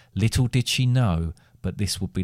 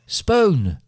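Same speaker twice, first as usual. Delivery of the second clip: loud high shout, falling sharply; shouted call; 10 semitones higher, 5 dB louder than usual. A narrator's reading. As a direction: shout her name louder, dropping at the end. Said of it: "by her mother shouting from the other room".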